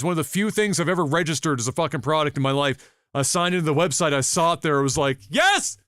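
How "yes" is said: triumphant cheer. Retold or imitated. Telling a friend he found the warranty registered, yelling in high triumph. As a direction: cheer like you just won big.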